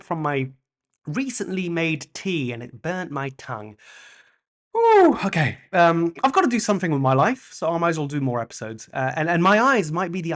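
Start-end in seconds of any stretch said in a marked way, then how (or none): 4.8-5.6 high, loud yelp of pain; real sharp ouch. Real, not acted. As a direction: yelp at the burnt tongue. Burnt tongue from tea.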